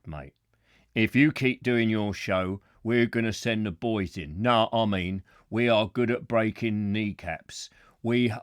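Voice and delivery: low, mock-threatening thug; menacing heavy's voice. Host pretending a letter threatens him over his show, low thug voice. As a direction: play the gangland enforcer, pitched right down. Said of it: "I'll do the proper voice".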